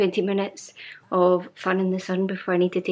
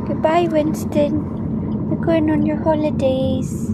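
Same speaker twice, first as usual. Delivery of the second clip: pet talk; 7 semitones higher, slower than usual. Acted. Saying goodbye to her cat.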